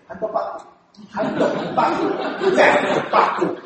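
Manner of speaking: handbag said slowly in a Japanese accent, syllable by syllable; Japanese English. Shown as a demonstration. Showing how a Japanese speaker says handbag, slow laughing Japanese accent. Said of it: "日本人念的".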